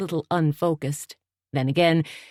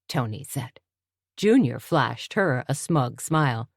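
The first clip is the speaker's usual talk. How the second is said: ordinary delivery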